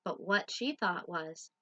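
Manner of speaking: lively loud picture-book read; reading to children. Acted. Teacher reading Duck on a Bike to children. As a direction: read it out big for little listeners.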